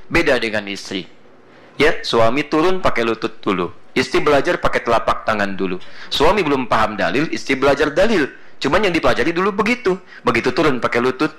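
normal talking voice

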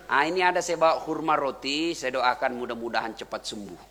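soft, low, kindly voice; gentle well-wishing. Retold or imitated. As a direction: quietly comfort someone who is ill. Prophet comforts his sick tormentor.

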